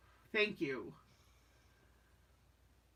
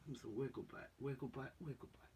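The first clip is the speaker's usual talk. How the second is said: much softer, lower cooing sing-song; 6 semitones lower, 14 dB quieter, much faster than usual. Real, not acted. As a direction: coo to the sleepy dog so as not to wake him. Cooing to sleeping dog.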